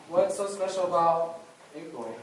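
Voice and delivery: ordinary delivery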